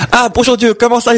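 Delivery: high-pitched, casual hello to God; acted cheery greeting. Saluent Dieu dans la blague. (salutation enjouée, voix haut perchée)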